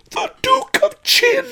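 shrieked very high and loud; terrified scream. A narrator's reading. Pawnbroker giving up the thief before lightning strikes, screamed. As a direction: scream the name in panic. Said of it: "screamed Pawnbroker Fang".

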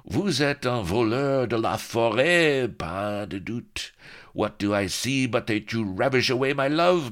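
high, loud, theatrical French pirate; French accent. Acted. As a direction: preen like a French duellist. Happy Dan's French speech.

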